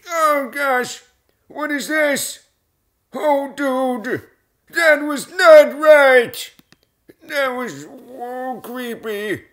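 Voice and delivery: very high, squeaky alarm; mock freaked-out squeal. Host reacting to something creepy on screen, squeaky mock alarm.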